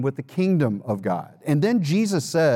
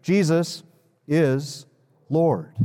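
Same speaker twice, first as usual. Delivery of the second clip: word by word, pausing between each; leading group recitation; much slower than usual. Shown as a demonstration. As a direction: lead the room one word at a time. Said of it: "So let's say it together again".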